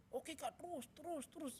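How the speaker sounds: high-pitched urging, as retold; acted parent's excited encouragement; cheering on. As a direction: egg the child on eagerly. Father cheering his child on.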